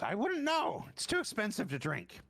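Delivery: sniffy high officer changing the subject; very high officer brushing off the question, then hurrying on. Acted. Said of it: "he, he, he goes".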